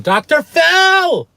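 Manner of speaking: high, slow, drawn-out mock scream. Sobbing friend on the phone, calling out for a TV therapist in a hammed-up scream. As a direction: belt the name out in a slow, high mock wail. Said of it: "you just wanna scream".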